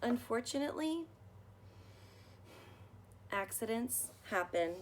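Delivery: put-on gentle read-aloud for children, measured pace; story narration. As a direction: read it like a bedtime picture book. Reading picture book aloud.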